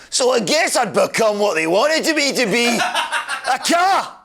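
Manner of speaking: a hammed-up Scottish accent, pitched high; mock Scottish brogue, quick and light. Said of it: "Oh, Scottish. I haven't done Scottish yet".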